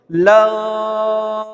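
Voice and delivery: लाम drawn very slowly, ending into m; long laam. Shown as a demonstration. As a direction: stretch लाम five alifs. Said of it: "पांच अलिफ खींचेंगे". (लाम लंबा खींचकर, आखिर में मीम की आवाज़)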